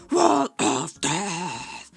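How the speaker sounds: low, drawn-out mock metal scream; metal crowd scream. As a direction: bellow it long and low like someone at a metal gig. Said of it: "someone screams".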